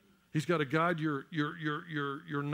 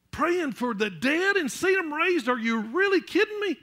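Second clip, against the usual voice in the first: rising, louder, slowing preacher's cry; fervent preaching; 7 semitones higher, 4 dB louder than usual. Acted. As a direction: build the sermon to a fervent climax. Preaching at full force.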